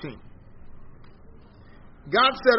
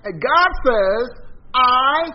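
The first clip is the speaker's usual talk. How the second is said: higher and louder preaching voice; ringing pulpit delivery; fervent preaching; 6 semitones higher, 4 dB louder, much slower than usual. Acted. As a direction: preach it out to the congregation. Preaching at full force.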